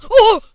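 sudden shriek of fright; startled scream. Real, not acted. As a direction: yelp out in shock. Jump scare in game.

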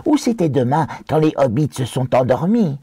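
créature qui étire ses reproches; Gollum réprobateur. Acted. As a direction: gronder les hobbits d'une voix de créature. (Gollum réprobateur, voix contrefaite)

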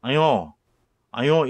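high, loud friendly calling out; 친근하게 크게 부르는 사투리; friendly dialect call. Retold or imitated. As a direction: hail someone across the street. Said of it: "친근하게 옆에 사람을 부르거나".

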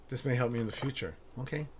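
ordinary delivery

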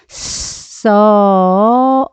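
써 with a long s sliding into a held vowel; slow blending. Shown as a demonstration. Teacher adds s to the vowel, 써 drawn out. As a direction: blend s into 어, stretching 써 right out. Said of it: "어에다가 스를 넣어주시니까".

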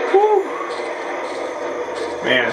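ordinary talk, nothing marked

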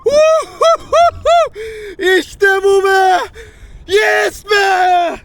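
wild triumphant yells, very high and loud; ecstatic shouting. Real, not acted. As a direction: scream with joy at the catch. Catching a big fish, ecstatic. (coşkuyla bağırarak)

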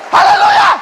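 soaring high shout of praise; high, loud hallelujah; ecstatic hallelujah. Acted. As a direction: cry it out to the rafters.

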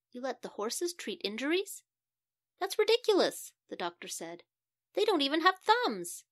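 can't believe they let horses treat him; incredulous doctor. Acted.

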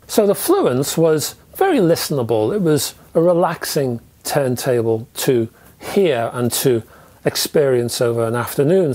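ordinary talk

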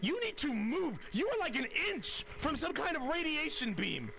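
high-pitched, alarmed urging; urgent warning. Acted. Gamer warns colleague off the radiation beam, urgent warning. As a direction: warn them out of danger.

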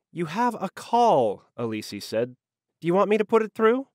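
high, lightly voiced curt secretary; a hint of a bored secretary; dry secretary. A narrator's reading. Elise on the intercom.

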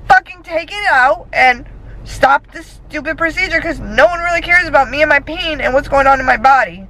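venting hard after a painful procedure; pissed. Real, not acted. Ranting to camera after the painful procedure, angry. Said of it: "I'm yelling, I'm a little loopy".

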